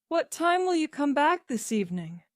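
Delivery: mother anxiously asking her son. A narrator's reading. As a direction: ask with a mother's worry. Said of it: "asked Missus Jones anxiously".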